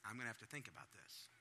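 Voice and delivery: mock-sinister dad; ominous calm. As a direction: make them squirm a little. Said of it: "What are you gonna do, Dad".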